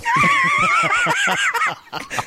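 loud, high villain cackle; high cackling laugh. Acted. Coach role loud laugh.

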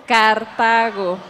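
"Cartago" stretched out syllable by syllable; slow clarification. Shown as a demonstration. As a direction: say "Cartago" very slowly, car-TA-go. Repeats the name after ¿Cómo?.

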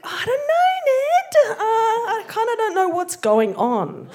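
mock-panicked, giggly and high; nervous squeak. Woman unsure about the Templars' challenge, squeaking nervously with laughter. Her nervous reply to Ned.

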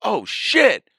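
sudden high startled cry; startled exclamation. Retold or imitated. Something crazy happens, high startled exclamation. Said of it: "you go".

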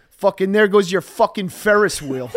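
loud, laughing mock rant; grumpy dad spoiling the fun; angry dad. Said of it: "and go".

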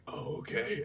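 soft breathless mock letdown; mock deflated murmur; deflated whisper. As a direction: barely breathe it out. Doctor deflated.

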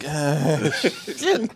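laughing, pained mock groan. Reacting to terrible accents, a laughing groan. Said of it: "I was like".

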